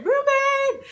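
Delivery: high, loud call of a name; mock shout. Author calling out for her missing hero. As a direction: yell his name like he's gone missing.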